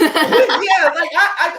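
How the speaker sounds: laughing. Real, not acted. Laughing at host's joke.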